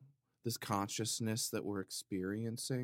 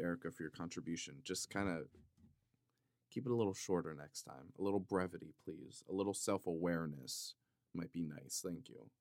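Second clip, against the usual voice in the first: sarcastic aside; 4 semitones lower, 7 dB quieter, faster than usual. Pretend. Passive-aggressive thanks.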